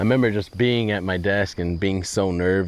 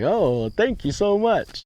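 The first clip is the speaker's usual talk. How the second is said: high, slow, flattered thank-you; much higher and slower, pleased and flattered; gushing thanks; 13 semitones higher, slower than usual. Retold or imitated. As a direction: lift way up into a grateful gush. Naive thanks for a compliment.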